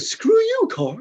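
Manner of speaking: sudden high shout of road rage; hammed-up yell at the driver behind; mock yell at a car. Retelling road rage to the therapist, mock angry shout. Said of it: "I said, I said".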